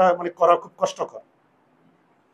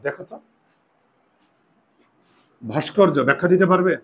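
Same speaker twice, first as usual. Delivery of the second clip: usual speaking voice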